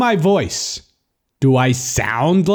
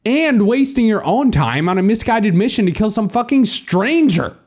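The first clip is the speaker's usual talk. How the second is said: high-pitched sweary tirade; exasperated rant; 11 semitones higher than usual. Acted. Narrator argues with the doubting caller, exasperated. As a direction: rail at him in disbelief.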